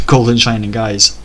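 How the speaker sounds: ordinary delivery, nothing marked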